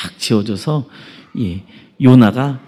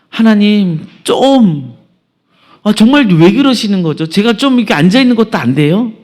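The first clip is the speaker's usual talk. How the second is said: high, louder plea of grievance; acted resentful complaint; 8 semitones higher, 4 dB louder than usual. Jonah blames God for the scorching heat, acting resentful. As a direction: whine upward in exasperation at God. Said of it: "하나님을 원망합니다".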